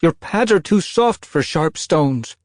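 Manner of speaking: high, loud worried queen calling; anxious call. A narrator's reading. Mother cat calling her kit back, anxious. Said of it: "a queen called anxiously".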